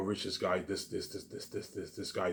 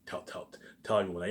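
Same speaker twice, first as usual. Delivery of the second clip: ordinary delivery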